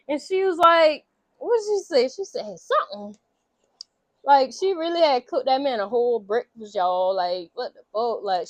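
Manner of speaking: usual speaking voice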